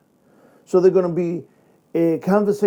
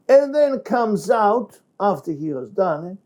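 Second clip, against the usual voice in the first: ordinary delivery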